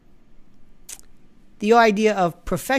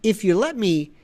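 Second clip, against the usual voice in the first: normal talking voice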